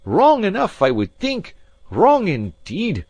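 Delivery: loud high outraged yell, slowing at the end; scornful fury, dragged out; furious shout. A narrator's reading. Alan mocking the maid's question, furious. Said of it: "with a kind of fury".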